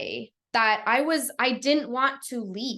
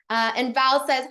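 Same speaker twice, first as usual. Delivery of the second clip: ordinary talk, nothing marked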